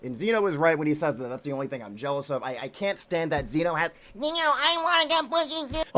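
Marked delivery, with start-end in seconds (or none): 4.1-6.0 high-pitched mocking whine; mock whiner. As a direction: whine it like a jealous kid, hammed-up. Mocks rival as a jealous whiner.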